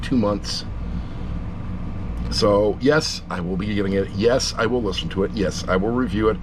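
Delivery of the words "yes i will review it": ordinary delivery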